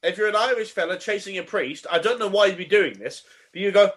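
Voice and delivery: ordinary talk, nothing marked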